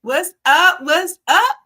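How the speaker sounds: loud, high, rising show greeting; hyped-up greeting. Real, not acted. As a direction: call it out big, lifting each "up". Co-host hypes up her entrance.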